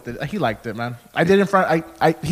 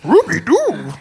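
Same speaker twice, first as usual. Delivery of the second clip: higher, loud cartoon dog voice; Scooby-Doo; 6 semitones higher, 4 dB louder, slower than usual. Shown as a demonstration. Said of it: "obviously from Scooby-Doo".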